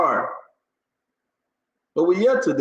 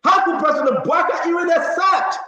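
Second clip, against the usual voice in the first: emphatic shout; 10 semitones higher than usual. Real, not acted. Host repeats question, shouting.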